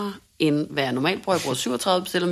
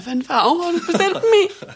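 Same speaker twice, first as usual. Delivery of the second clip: tearful question; 11 semitones higher, 4 dB louder than usual. Retold or imitated. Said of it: "med tårer i øjnene".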